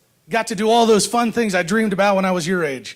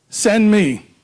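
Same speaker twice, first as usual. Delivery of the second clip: podium speech. Acted. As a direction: stir up the crowd from the podium. Rousing speech to rally crowd.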